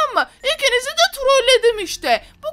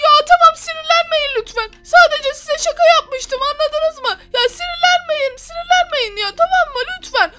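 higher, pleading voice; wife begging everyone to calm down, in a higher voice; 6 semitones higher than usual. Acted. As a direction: beg them to calm down. Calming angry husband.

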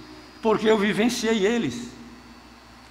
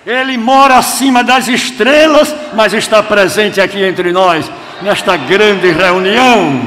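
loud, ringing proclamation, slowing at the end; full-force preaching; 2 semitones higher, 11 dB louder than usual. Real, not acted. Preacher tells the congregation God is present, full-force preaching. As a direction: proclaim it loudly to the whole hall.

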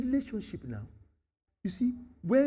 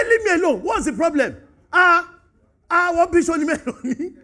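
loud, high, irritated pushback; rude dismissal; 9 semitones higher, 12 dB louder than usual. Retold or imitated. Cain telling God to leave him alone, loud and annoyed. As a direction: wave God off rudely. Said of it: "how he spoke to God".

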